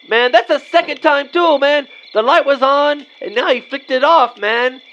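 high-pitched aggrieved complaint; indignant. Acted. Porch light switched off on them.

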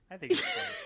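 high, laughing squeal on "I think it was fudge"; giggling high squeal. Real, not acted. Joking squeal about fudge.

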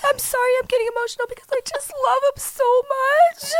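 high-pitched hammed-up sobbing; imitated crying. Imitating a dating-video girl apologizing for emotion, mock crying. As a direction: squeak it out through mock tears. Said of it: "starts crying again".

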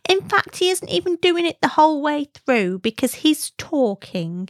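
put-on high-pitched little girl voice, complaining; impression of daughter. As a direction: put on a child's high voice, lecturing Mummy. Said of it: "me doing an impression of her voice".

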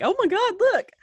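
high-pitched fan-like excitement; excited squeal. Retold or imitated. Podcaster re-enacting seeing their first shout-out, excited squeal. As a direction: squeal it like thrilled unknowns.

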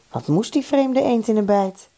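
ordinary delivery, nothing marked

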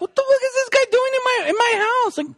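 husband fuming about the intruder in his house; outraged husband. Retold or imitated. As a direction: play the jealous husband's shocked outrage. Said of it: "as a man, like, being like".

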